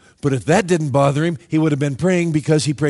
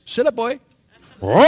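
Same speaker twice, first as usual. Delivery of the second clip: playful command; 8 semitones higher than usual. Pretend. Angels teaching lion tricks.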